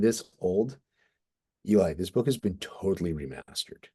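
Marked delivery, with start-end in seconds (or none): none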